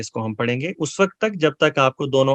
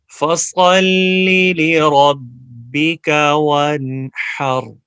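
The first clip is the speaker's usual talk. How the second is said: Quran recitation; 2 semitones higher, much slower than usual. Shown as a demonstration. Teacher recites the verse slowly for students to repeat. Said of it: "اب زبانی پڑھنے".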